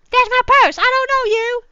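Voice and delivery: very high, loud squawk; high loud cartoon kid yelling. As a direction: screech it like the meme kid. [name] meme quote shouted.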